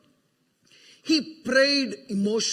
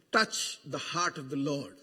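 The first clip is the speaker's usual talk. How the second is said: hushed; 11 semitones lower, 10 dB quieter than usual. Acted. Repeated phrase, soft.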